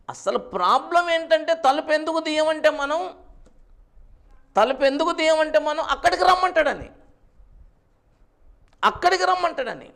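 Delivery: loud, high preacher's delivery; full-force preaching. Real, not acted. Preacher asks congregation about Cain's offering, full-force preaching.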